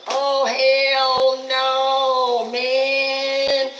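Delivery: loud, high, long drawn-out protest; mock her outraged holler, stretching every word; slow high-pitched indignant wail; mimicked indignant housemate. Mimics angry housemate's outburst.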